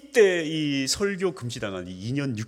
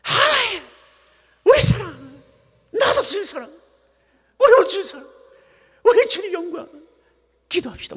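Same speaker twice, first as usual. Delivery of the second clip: Gyeongsang preacher, much higher and louder, clipped fragments; Gyeongsang dialect preaching; 8 dB louder than usual. Retold or imitated. Pastor mocking his old Gyeongsang dialect sermons, high and loud. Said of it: "사투리를 고칠 생각이 없었거든요".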